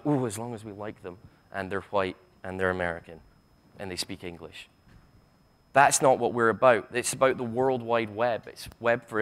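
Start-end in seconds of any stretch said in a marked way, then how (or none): 0.1-4.7 mocking caveat. Mocks the slogan's hidden exclusions.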